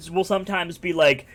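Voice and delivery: normal talking voice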